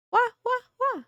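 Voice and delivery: very high playful cat meows; meowing. Pretend. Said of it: "Good meowing".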